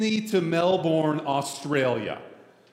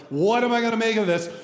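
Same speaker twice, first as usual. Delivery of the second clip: raised, quick voice of bafflement; mock exasperation; 7 semitones higher, 5 dB louder, much faster than usual. Pastor facing a difficult parable, mock exasperation. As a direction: act out being stumped, louder and higher.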